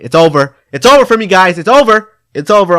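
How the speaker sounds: loud, high, hammed-up wailing; mock panic. Kid fearing arrest, wailing to his viewers, mock panic. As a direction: wail it like the world is ending.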